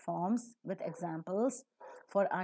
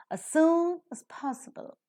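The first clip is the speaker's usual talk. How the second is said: soon hit higher and louder, as reduced; stress on soon; 9 semitones higher, 5 dB louder than usual. Shown as a demonstration. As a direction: lean on soon, let as go weak. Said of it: "soon is accented".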